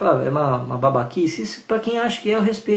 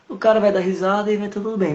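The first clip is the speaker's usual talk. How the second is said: usual speaking voice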